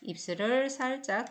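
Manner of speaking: normal talking voice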